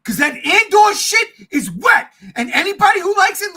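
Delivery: loud, high-pitched furious rant; mad rant. Real, not acted. Host telling fans why Andor is whack, furious. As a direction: launch in at full volume, pitch way up. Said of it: "that's why I'm mad".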